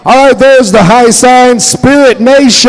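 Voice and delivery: loud, high emcee call; announcer cue. Acted. Emcee cues Spirit Nation to start the grass dance, announcer voice. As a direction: launch the song with energy.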